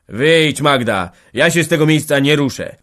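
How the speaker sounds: loud, obstinate tone; unyielding. A narrator's reading. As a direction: dig in and refuse to budge. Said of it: "wycedził nieustępliwie Kowal".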